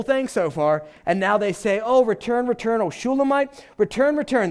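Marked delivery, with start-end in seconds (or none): 1.8-4.5 high, eager plea pitched well up; excited calling. Retold or imitated. Daughters of Jerusalem calling the Shulamite back, excited. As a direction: call them back excitedly in a raised voice. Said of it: "And they're so excited".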